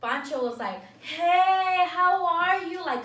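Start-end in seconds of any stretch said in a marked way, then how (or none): 1.0-2.8 loud, high, gushing imitation of a hello; showy big-deal greeting. Groom greeting the girlfriend in front of them, showily effusive. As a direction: make a big show of greeting her. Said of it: "making a big deal out of it".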